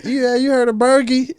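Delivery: high, loud questioning voice; mock mispronouncing stranger. Rapper mimicking someone looking him up, high mock question. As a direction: imitate a clueless asker, pitch way up. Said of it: "they gonna be like".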